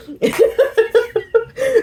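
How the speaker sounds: high-pitched cackling laugh; giddy high cackle. Real, not acted. Laughing at her story.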